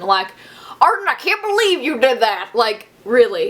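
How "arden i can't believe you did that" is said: angry Southern parent. Retold or imitated. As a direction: scold like a Southern mom. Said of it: "they legitimately get straight Southern".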